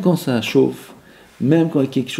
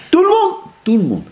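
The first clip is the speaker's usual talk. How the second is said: crie haut et fort; martèle, tout le monde; insistant; 12 semitones higher than usual. Shown as a demonstration. (insiste très haut)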